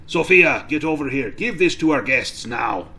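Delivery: gruff innkeeper calling out loudly; loud, impatient command; barked order. Acted. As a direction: summon the serving girl sharply. Innkeeper orders the girl over.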